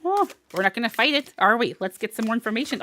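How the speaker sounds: ordinary talk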